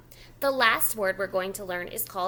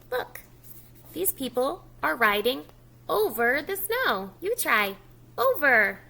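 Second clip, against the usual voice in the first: lively louder story reading; reading to children; 5 dB louder than usual. Acted. Teacher reading the book aloud to young children. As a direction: read the snow story aloud to a preschool audience.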